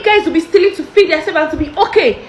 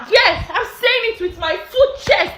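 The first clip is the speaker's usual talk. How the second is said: normal talking voice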